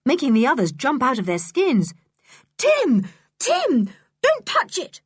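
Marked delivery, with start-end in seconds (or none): 2.6-5.0 very high, urgent warning cry; high-pitched, frantic calling; panicked yell. Acted. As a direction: yell to stop the dog in alarm. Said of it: "she yelled to Timothy".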